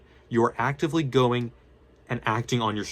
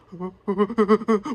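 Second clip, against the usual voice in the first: nervous laugh; 9 semitones higher, 5 dB louder than usual. Retold or imitated. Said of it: "bad stress is being like".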